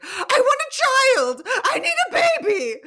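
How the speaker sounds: high, loud hammed-up wail; exaggerated high-pitched pleading, imitating someone; mock desperate demand. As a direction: shriek it like a frantic caricature. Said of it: "she's just like".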